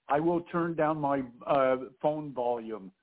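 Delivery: ordinary delivery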